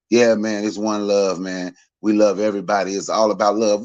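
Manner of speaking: low, laid-back one-love voice; lazy, low Rasta guy preaching love; mock Rasta dude. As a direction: imitate a mellow corner-store Rasta, pitched low. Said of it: "It's a dude I used to see at the corner store".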